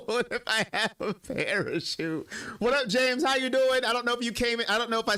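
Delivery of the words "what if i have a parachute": reading the line through real laughter; laughing. Real, not acted. Streamer laughing while reading chat's joke. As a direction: laugh while getting the words out.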